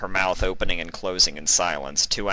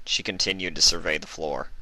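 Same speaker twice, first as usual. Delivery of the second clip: usual speaking voice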